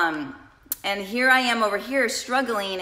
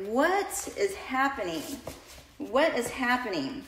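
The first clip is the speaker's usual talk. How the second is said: retold bewilderment; 3 semitones higher, 5 dB quieter than usual. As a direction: stretch it out, quietly baffled. Retelling bewilderment at getting no response.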